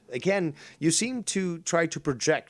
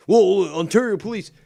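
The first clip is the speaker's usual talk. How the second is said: sarcastic mimic; 6 semitones higher, 6 dB louder than usual.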